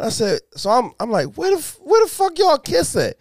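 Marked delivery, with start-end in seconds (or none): 1.3-3.2 much higher, incredulous; high incredulous demand. Retold or imitated. Father grilling daughter about her kiss, incredulous. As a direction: demand an answer in comic outrage.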